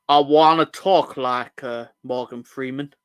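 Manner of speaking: slow mock voice. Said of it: "That's nothing like Morgan Freeman".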